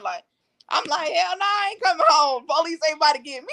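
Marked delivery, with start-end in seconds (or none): 1.1-3.5 much higher, louder defiant yell; retold shout. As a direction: shout the refusal back, defiant. Retold refusal to come home.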